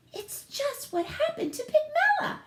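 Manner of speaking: high, squeaky wife voice; the wife breaks the news to her husband, high and squeaky; farmer's wife voice. A narrator's reading. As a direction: chirp it up like an excited farm wife. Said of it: "she told her husband".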